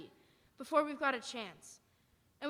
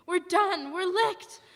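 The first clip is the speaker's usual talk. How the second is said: despairing outburst; 7 semitones higher, 7 dB louder, slower than usual. Acted. Soldier despairs over the Martians.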